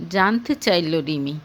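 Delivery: ordinary delivery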